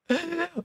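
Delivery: high, breathy giggle; soft high giggle; high laugh. Real, not acted. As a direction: burst out laughing. Laughs at player name.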